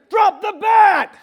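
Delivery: slow, high desperate shout; yelling. Retold or imitated. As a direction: bellow it at the runner. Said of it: "And then I hear".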